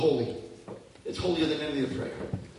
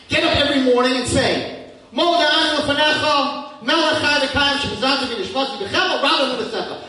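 preaching at full force; 11 semitones higher, 11 dB louder than usual. Acted. Preacher urging listeners to pray every morning, loud and high. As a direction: cry the words out to the congregation.

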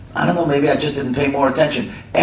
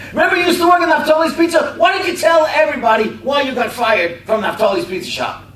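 accusing shout; 13 semitones higher, 4 dB louder than usual. Retold or imitated. Man publicly accuses Paul, shouting.

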